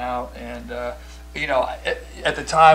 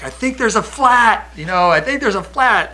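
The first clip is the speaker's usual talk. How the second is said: higher, louder worried teammates; 10 semitones higher, 6 dB louder than usual. Retold or imitated. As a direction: voice the guys noticing a flat tire. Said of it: "the guys are like".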